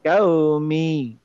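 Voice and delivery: "কাওমি" very slow, glide held; light lin stretch. Shown as a demonstration. Teacher reading for students to follow, "কাওমি" stretched. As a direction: draw out both syllables of "কাওমি". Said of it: "একটু হালকা লিন হয়ে যেন উচ্চারণ করি".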